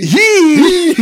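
very high, loud squeak; tiny high-pitched battle cry; squeaky battle cry. Pretend. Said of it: "It was a battle cry".

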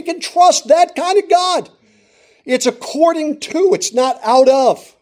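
high, loud, impassioned sermon climax; full-force preaching. Acted. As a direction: drive the point home at full volume.

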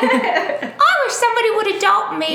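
high mock Cockney orphan, half laughing; Cockney chimney sweep. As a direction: plead like a hammed-up Cockney urchin. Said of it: "he's a chimbley sweep".

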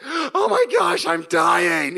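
high, loud panicked shriek; panicked scream. Retold or imitated. Retelling his boyhood fence injury, a loud panicked shriek. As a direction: scream it like a bleeding kid. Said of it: "but I was like".

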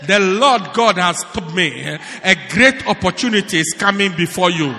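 loud, declamatory prophet act; mock prophesying. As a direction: proclaim it like a show prophecy. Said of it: "I have a prophecy for you".

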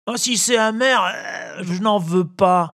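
put-on high whining puppet boy; whiny child. A narrator's reading. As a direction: whine and refuse the medicine. Whiny Pinocchio refuses. (voix d'enfant pleurnicheur)

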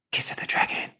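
breathy menacing whisper; villain's threatening whisper. Retold or imitated. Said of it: "villain's whispered punchline".

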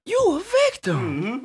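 mock echo voice. Teasing echo, soundboard wish.

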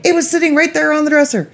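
high, fast, amazed rant; daughter-in-law telling about her lost brush, high and fast; excited disbelief. Retold or imitated. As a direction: rattle it off, incredulous. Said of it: "She goes".

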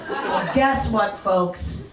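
ordinary talk, nothing marked

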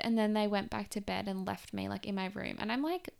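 normal talking voice

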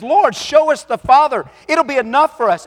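high, loud pleading Philip; eager Philip. Acted. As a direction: beg Jesus to show the Father. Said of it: "Philip chimes in".

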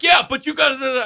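high shouted interruption; yelling cop. Retold or imitated. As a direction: cut him off with a yell. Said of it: "You don't need to yell at me".

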